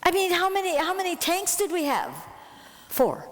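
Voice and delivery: high, pressing mock exasperation; exasperated scolding. Angry retold scolding at crew.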